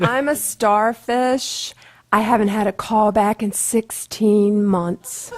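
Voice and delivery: loud kindergartner one-liner voice; starfish voice. Acted. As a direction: belt it out like a kid in a play. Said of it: "You gonna give us the voice?".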